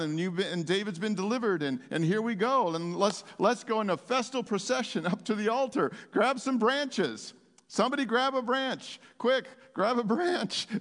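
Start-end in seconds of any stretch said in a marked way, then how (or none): 6.1-10.7 higher-pitched, eager calling out; rally the crowd with excited commands; urgent rallying call. Pretend. Preacher rallying the congregation to grab branches, excited calling out.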